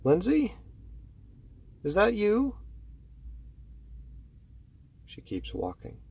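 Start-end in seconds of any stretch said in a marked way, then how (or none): 0.0-2.6 high sing-song call up the stairs; auntie's high calling voice; aunt calling from upstairs. Acted. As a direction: call down sweetly to the girl. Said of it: "We hear from upstairs".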